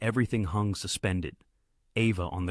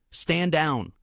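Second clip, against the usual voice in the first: higher, louder order to stand down; commanding; 8 semitones higher, 5 dB louder than usual. A narrator's reading. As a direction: cut through the standoff with a command. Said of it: "a new voice commanded".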